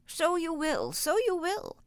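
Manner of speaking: put-on high chuckling little old man; a queer old man chuckling. A narrator's reading. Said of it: "chuckled the queer little old man".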